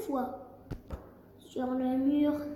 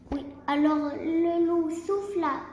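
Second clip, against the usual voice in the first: plain reading voice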